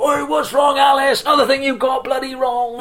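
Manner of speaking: high loud mocking shout; fan banter. Gloucester fans heckling the new reporter, loud jeer. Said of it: "all the fans would go".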